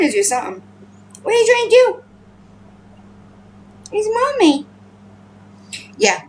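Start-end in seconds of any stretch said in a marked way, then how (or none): 1.2-4.7 pet talk. Real, not acted. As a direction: coo up high at a little animal.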